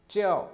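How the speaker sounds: च्याओ said high and loud, dropping sharply; falling fourth tone. Shown as a demonstration. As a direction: snap च्याओ down from a high start. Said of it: "fourth tone है च्याओ".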